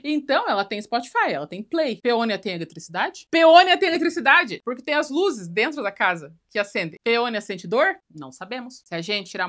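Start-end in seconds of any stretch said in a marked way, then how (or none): 3.3-4.6 loud, higher declaration; loud comic insistence on the answer; emphatic exclamation. Real, not acted. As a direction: belt it out as a big revelation. Answers own question with emphasis.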